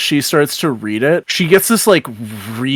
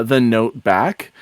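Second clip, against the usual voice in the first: normal talking voice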